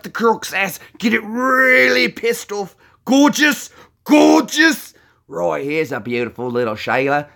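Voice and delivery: broad Aussie croc-wrangler showman; Steve Irwin. Acted. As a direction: push the Aussie accent into giddy excitement. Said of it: "that's Steve Irwin".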